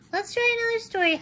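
put-on chirpy quick child voice; Little Red Chicken. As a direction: rush it like an excited little chick. Chicken repeats Papa eagerly.

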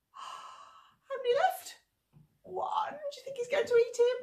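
very high, excited and fearful; mock gasping suspense. Leader gasping in mock fear for the monkey. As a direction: squeal with pretend worry.

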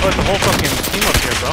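alarmed shout. Real, not acted. Sudden enemy team.

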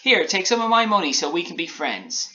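high-pitched gingerbread man; talkative biscuit. A narrator's reading. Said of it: "replied the talkative biscuit".

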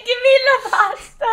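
very high, loud cartoon Italian; hammed-up Italian accent after a laughing fit; mock Italian accent. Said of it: "Why does he have an Italian accent".

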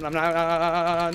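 ordinary talk, nothing marked

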